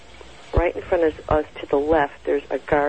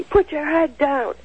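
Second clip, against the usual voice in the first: higher, slow mock command; retold guard's order; 11 semitones higher, slower than usual. Guard ordering the wife at the gate, retold stern command. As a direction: imitate a guard ordering her, drawn out and high-pitched. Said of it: "so he said to me".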